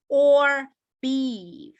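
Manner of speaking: loud 'or', then 'beef' falling; drawn-out 'or beef' with a closing fall; falling final option. Shown as a demonstration. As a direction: end the list by dropping down on beef. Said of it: "biasanya dia menurun ke bawah".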